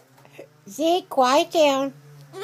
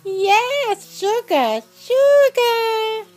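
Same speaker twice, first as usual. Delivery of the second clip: sing-song high coo to the pet bird; 11 semitones higher, 4 dB louder, much slower than usual. Real, not acted. Owner cooing at her pet bird, high and sing-song. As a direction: coo the name up high, stretched.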